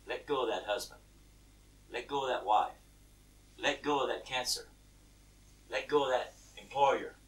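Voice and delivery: low, soft, measured commands; voice of God. Acted. God counselling a troubled believer, low and gentle. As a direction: say each one deep and quiet. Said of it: "God says".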